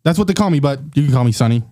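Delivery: plain reading voice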